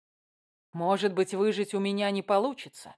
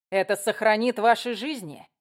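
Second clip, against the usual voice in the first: louder, cold menacing prince; 3 semitones higher, 5 dB louder than usual. Acted. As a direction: threaten with icy authority. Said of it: "Голос Кирана звучал холодно".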